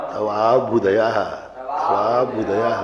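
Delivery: तवाभ्युदयः with वा joined to आ; correct pronunciation. Shown as a demonstration. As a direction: say तवाभ्युदयः slowly, clearly. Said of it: "तो कैसा पड़ेगा?". (शुद्ध उच्चारण तवाभ्युदयः)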